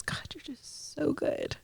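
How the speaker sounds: hushed, breathy thanks to God; awed whispered prayer. Retold or imitated. A grieving mother whispering thanks to God, hushed. Praying in awe after miscarriage.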